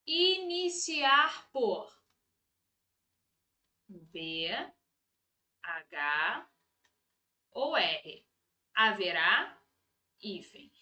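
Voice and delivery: halting, low, item by item; dropping low while writing it out; slow dictation. Real, not acted. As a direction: dictate in pieces with long pauses. Dictates letters while writing them.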